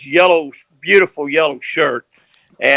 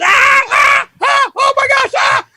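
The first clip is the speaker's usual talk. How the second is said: retold scream; slower than usual. Fishing buddy yelps as the jig hits his head, high screaming. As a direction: shriek it like you just got hooked. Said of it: "Dance hollers".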